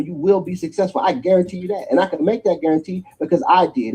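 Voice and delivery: usual speaking voice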